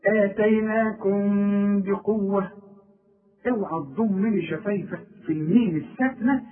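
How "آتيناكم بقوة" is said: آتيناكم بقوة slow, with the final m held as a long hum; sustained, level nasal m in آتيناكم بقوة; nasal concealment. Shown as a demonstration. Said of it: "يحصل لها إخفاء".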